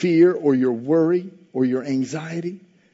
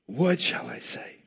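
softer, slower, turned inward; hushed musing; 2 semitones lower, 9 dB quieter, slower than usual. Shown as a demonstration. Preacher voicing Jesus's inner question, hushed and slow. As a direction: ask it quietly, as if to yourself. Repeated line, second take hushed.

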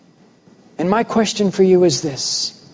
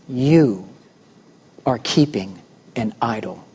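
low, grave, much softer pronouncement; solemn divine warning in a lowered voice; voice of God; 7 semitones lower, 4 dB quieter than usual. Retold or imitated. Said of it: "and he says".

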